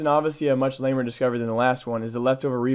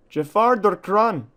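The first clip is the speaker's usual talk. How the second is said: slow, louder, higher hammed-up German accent; 8 semitones higher, 6 dB louder, slower than usual. Reading a crane danger sign, slow loud mock German accent. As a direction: drag it out like a mock German guard. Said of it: "sorry for my terrible German accent".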